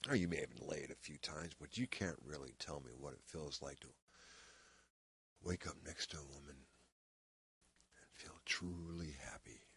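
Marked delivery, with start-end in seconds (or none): 2.2-9.6 slow, near-whispered intimacy; quiet and lingering, dropping away at the end; hushed reflection. Acted. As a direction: let it sink slowly into a soft breath. Acted monologue, intimate reflection on love.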